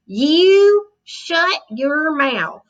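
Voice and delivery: drawn-out Texas threat; angry Texas cowboy. A narrator's reading. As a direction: Texas cowboy snapping at the boy. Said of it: "strong Texas accent".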